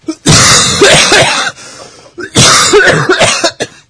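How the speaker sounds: harsh chesty cough; loud hacking cough. Real, not acted. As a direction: cough hard into the mic.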